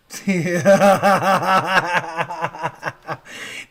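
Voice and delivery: long loud laughing fit; loud burst of laughter. Real, not acted. Laughing at the voice bit.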